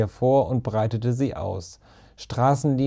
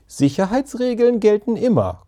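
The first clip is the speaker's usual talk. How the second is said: much higher, prim comeback; indignant retort; 10 semitones higher, 3 dB louder than usual. A narrator's reading. Tim counters Ben's teasing about rules, indignant. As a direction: counter it in a high, insistent voice. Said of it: "konterte Tim".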